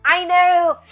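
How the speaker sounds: high, loud burst of agreement; loud exclamation. Real, not acted. Streamer answers a cheeky viewer alert, loud exclamation.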